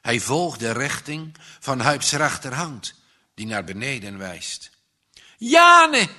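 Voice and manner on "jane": zeer hoog, luide gil; wanhopige schreeuw. A narrator's reading. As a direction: schreeuw haar naam. Jongen schreeuwt naam vriendin. (wanhopige gil)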